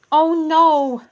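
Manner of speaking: high, loud shocked "Oh no"; gasping. Acted. Ruby sees the ice on the pot, gasping in dismay. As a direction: gasp it out in dismay. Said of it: "Ruby gasped".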